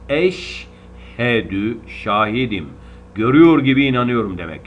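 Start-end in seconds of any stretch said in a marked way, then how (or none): 0.1-1.8 eşhedü drawn out very slowly with clear h; slow recitation. Shown as a demonstration. Teacher repeating the correct form slowly. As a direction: recite eşhedü slowly, every sound distinct. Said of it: "Doğrusu bu".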